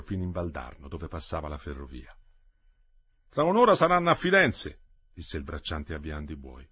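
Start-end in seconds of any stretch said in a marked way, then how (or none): 3.3-4.8 higher, louder farmhand voice. Acted. Farmhand telling grandmother the train times, loud and high. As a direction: call it out plainly, like a rough farm worker.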